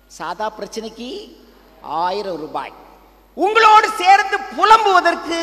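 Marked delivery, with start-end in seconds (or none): 3.3-5.4 high, loud hammed-up lament; mock wailing cry. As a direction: wail it like a mock mourner. Priest mocks joining people's laments.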